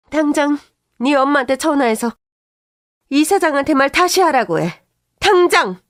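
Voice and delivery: loud, high, raging shouts; furious yell. Retold or imitated. Mother-in-law ordering her to call her mother now, furious yelling. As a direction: scream the order in fury.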